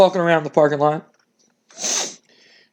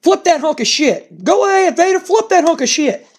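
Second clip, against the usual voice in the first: Jim Cornette ordering; 13 semitones higher than usual. Retold or imitated. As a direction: bark the order at the big man, high and gleeful. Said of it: "And Jim Cornette goes".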